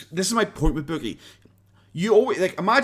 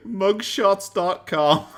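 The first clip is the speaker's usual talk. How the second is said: slow, higher-pitched words through real laughter; laughing; 6 semitones higher, 3 dB louder, much slower than usual. Real, not acted. Commentator relishing the mugshots dot com joke, laughing. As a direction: draw it out while cracking up.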